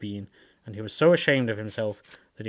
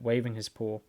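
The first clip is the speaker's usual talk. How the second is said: plain reading voice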